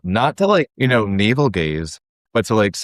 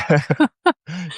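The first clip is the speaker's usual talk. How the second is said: laugh; 11 semitones higher than usual. Real, not acted. Laughs at work talk story.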